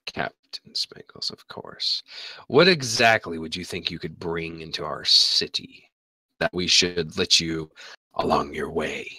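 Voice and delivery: low, whispery menacing priestess. Acted. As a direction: breathe it out low and threatening. DM voices priestess.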